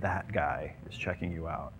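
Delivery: low, hushed aside; sly undertone; confidential teasing. Acted. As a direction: say it very softly, as if pointing someone out secretly. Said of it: "find a real dorky looking guy and you go".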